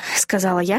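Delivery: ordinary delivery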